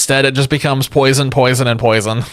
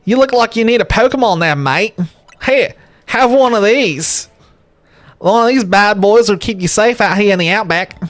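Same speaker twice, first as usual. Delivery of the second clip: high, hammed-up Aussie outback bloke; over-the-top Australian professor voice; Australian accent; 7 semitones higher than usual. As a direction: play a Steve Irwin type offering a starter. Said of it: "went from Australian to something else".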